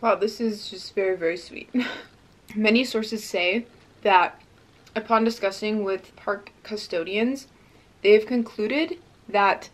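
talking with food in mouth; chewing while talking. Real, not acted. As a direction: mouth partly full of dessert. Seen 0: Lips closed, jaw working after tasting; hand near mouth after.

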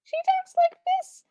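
tiny high squeaky cartoon voice; mouse voice. Shown as a demonstration. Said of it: "Cinderella has a mouse voice".

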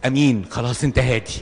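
mock appeasing. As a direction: calms down the shouting man, hastily placating. Scared listener appeases.

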